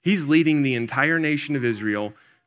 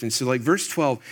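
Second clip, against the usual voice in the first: ordinary delivery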